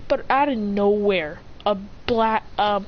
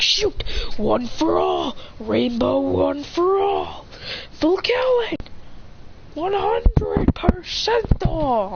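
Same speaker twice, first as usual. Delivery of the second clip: high, slow anime attack chant; drawn-out heroic announcement rising high; power-up call; 8 semitones higher, much slower than usual. Acted. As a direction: stretch out each move name as it builds. Acted power-up calls in story.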